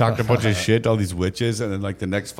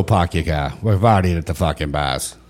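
put-on broad dropped-r Boston accent; mock Boston townie accent; 4 semitones lower than usual. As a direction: play a Boston party townie. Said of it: "that's the best accent".

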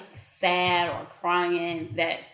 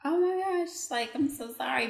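much higher, softer sympathetic voice; mock sympathy; 6 semitones higher, 4 dB quieter than usual. Charlotte retelling comforting a crying lady, higher and softer. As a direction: coo sorry to a crying stranger. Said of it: "I was just like".

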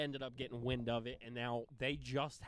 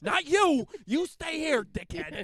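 high, loud Philly brush-off; Philly accent; 13 semitones higher, 8 dB louder, slower than usual. Retold or imitated. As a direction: wave him off in a thick Delco accent. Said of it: "the accent is what makes it funny".

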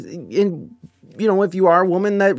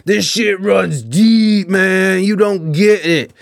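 put-on slow, drawn-out drunken-style bluster; raised, dragged-out mock rant; yelling trash talker; 3 semitones higher, 3 dB louder, slower than usual. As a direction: imitate a loud ranter stretching every key word. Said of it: "he's right back at it".

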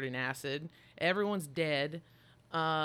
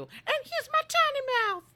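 much higher, louder squeaky little monster; tiny alien mouth voice; 7 dB louder than usual. Retold or imitated. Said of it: "I'd be like".

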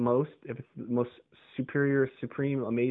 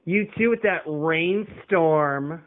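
slow, drawn-out condescending mimic, a touch louder; Conan O'Brien twang; 5 semitones higher, 7 dB louder, much slower than usual. Mocking the receptionist's parting line, condescending and slow. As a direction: stretch out "You too" with a mocking lilt. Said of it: "a little Conan O'Brien twang".

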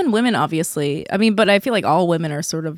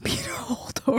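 whispered; 2 semitones higher, 10 dB quieter than usual. Real, not acted. Whispered repeat through a laugh.

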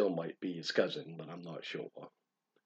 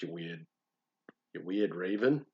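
normal talking voice

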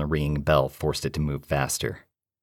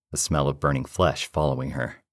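usual speaking voice

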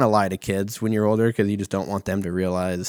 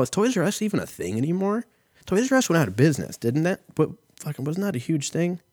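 excited; 9 semitones higher than usual. Real, not acted. Excited disbelief about Toys R Us.

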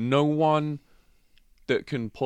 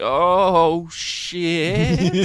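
shocked reaction; 7 semitones higher, 5 dB louder than usual. Retold or imitated. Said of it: "I'm like".

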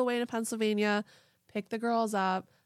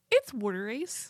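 Pennsylvania "wooder ice", pitched up; Pennsylvania accent; 3 semitones higher than usual. Shown as a demonstration. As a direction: insist in a mock Pennsylvania accent. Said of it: "they say water ice".

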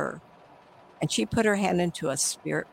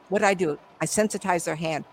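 ordinary delivery

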